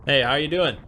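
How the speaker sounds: ordinary talk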